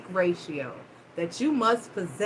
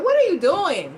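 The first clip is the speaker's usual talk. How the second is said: higher, louder exasperated demand; 7 semitones higher, 5 dB louder than usual. Retold or imitated. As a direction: confront him, sharp and fed up. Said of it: "I wanted to turn around and say something".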